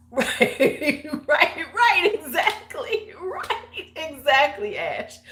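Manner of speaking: high-pitched, cracking up mid-word; laughing agreement. Real, not acted. Agreeing with Ash about the story, laughing hard.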